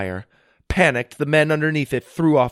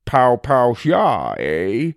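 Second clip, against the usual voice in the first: put-on louder, teasing old man echo; amused grandpa; 4 dB louder, much slower than usual. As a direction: tease him knowingly.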